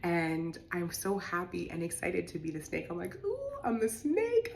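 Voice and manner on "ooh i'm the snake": much higher, delighted ooh, drawn out slowly; thrilled squeal. Retold or imitated. Retelling the ayahuasca vision, delighted high squeal. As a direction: squeal it like a kid discovering a gift. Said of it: "I'm so excited".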